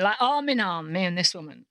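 usual speaking voice

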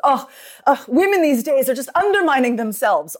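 hammed-up disapproval from an elder; mocking older generation. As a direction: imitate an appalled older woman complaining. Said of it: "older generations will always make fun".

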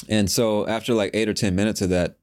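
ordinary talk

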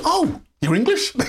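high-pitched shocked crew member; imitating a startled questioner. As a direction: leap up high in astonished disbelief. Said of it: "they're shocked".

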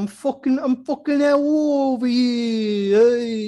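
high, loud wiseguy holler; mock Italian-American mobster. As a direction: holler it like a cartoon mob boss. Mocking Italians as tough mobsters.

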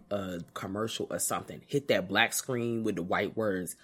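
ordinary delivery

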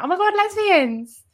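high, loud mock squeal; excited exclamation. Onlooker in Paris spots two women, excited squeal.

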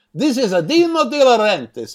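hammed-up Italian accent; over-the-top Italian accent. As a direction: announce yourself like a caricature Italian producer. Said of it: "most over-the-top and unbelievable Italian accent".

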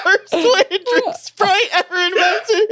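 words breaking into laughter; laughing while talking. Real, not acted. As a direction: still cracking up, slow.

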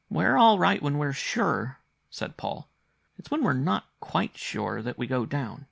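put-on high child voice, drawn out at first; earnest young boy. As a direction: explain it with a child's certainty. Paul answers as the boy.

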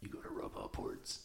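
low, very quiet whisper; hushed, voiceless aside. Pretend. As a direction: whisper it close to the mic. Rubbing noise beside the mic.

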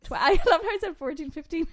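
high, loud words through laughter; laughing at herself. Real, not acted. [name] laughing at how she counted the ages. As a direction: laugh it out at your own mistake.

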